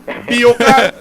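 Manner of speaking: "Biokal" said loud and clear, stress on "Bio"; pronounced on request. Shown as a demonstration. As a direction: spell out "Biokal" slowly and clearly for the listeners. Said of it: "[name], pronounce that again".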